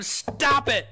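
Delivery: high, sharp shout; loud rebuke. Retold or imitated. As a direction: shout him down. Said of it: "So they get loud at him".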